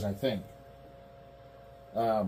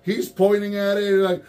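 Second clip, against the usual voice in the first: normal talking voice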